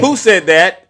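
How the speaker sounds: loud, high demand; challenging shout. Real, not acted. Streamer daring MAGA viewers, loud and high. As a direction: call them out hard.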